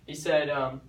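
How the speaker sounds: usual speaking voice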